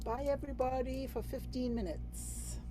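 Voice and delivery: ordinary talk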